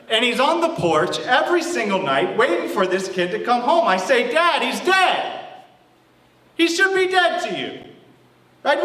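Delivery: high-pitched rant, building in outrage; indignant older son. Acted. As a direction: argue with Dad, voice climbing in protest. Older son rants at forgiving father.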